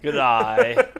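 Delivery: mock Australian "good eye"; Australian accent. Shown as a demonstration. Podcaster doing his only Australian line, mock Aussie. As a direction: play up the Aussie twang lightly.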